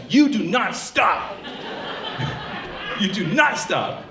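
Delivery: weight on every word of "do not stop"; emphatic command. Acted. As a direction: hammer each word of "do not stop" slowly.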